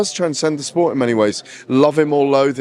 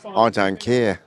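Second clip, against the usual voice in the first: put-on lower, lightly done Brummie accent; second try at a Brummie accent; 3 semitones lower, slower than usual. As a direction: have another go at "I don't care" in a Brummie accent. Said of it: "I mocked your accent like that".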